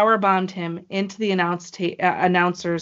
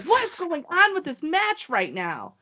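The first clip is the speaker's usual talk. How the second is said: high-pitched, incredulous outburst; frantic disbelief; 9 semitones higher than usual. Retold or imitated. Fan texting people mid-match, in frantic disbelief. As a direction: replay a fan's frantic disbelief.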